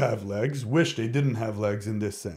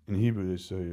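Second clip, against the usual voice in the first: ordinary talk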